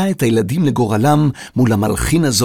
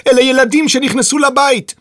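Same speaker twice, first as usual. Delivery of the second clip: high shouted explanation; shouting; 14 semitones higher, 4 dB louder than usual. A narrator's reading. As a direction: shout it so a half-deaf man hears. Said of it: "צעקה העוזרת".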